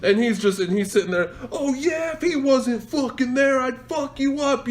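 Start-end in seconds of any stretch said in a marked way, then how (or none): 1.5-4.7 high, whiny-pitched caricature of bluster; mocked driver's threat. Imitates driver's empty threat.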